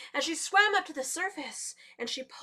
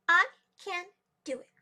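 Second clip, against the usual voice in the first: raised, loud, proud declaration; proud exclamation; 6 semitones higher, 9 dB louder than usual. A narrator's reading. Muskrat ignores the laughter, proudly exclaiming. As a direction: declare it boldly, word by word. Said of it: "very proudly exclaimed".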